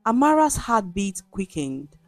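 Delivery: usual speaking voice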